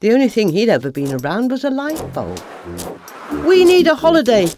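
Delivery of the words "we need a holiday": higher, louder, eager exclamation; exclaiming. Acted. Moth Malcolm excitedly proposes a holiday, higher and louder. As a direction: burst out with the idea. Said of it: "he exclaimed".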